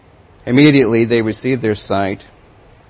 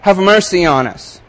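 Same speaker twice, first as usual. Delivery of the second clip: higher, louder desperate cry; shouting louder; 6 semitones higher, 4 dB louder than usual. Acted. Blind men shouting over the crowd, louder plea. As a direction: push past the crowd with your voice. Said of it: "they shouted all the louder".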